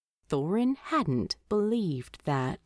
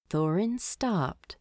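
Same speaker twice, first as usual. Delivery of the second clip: plain reading voice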